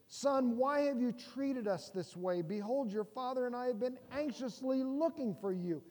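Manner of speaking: exasperated mother chewing out her son; anxious scolding mother. Retold or imitated. Mary scolding young Jesus, lightly voiced worried mom. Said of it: "You know the tone".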